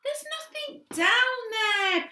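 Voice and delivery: high, slow desperate plea; pleading. A narrator's reading. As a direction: beg them to stop. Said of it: "pleaded Chloe".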